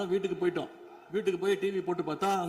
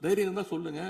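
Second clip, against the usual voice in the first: ordinary delivery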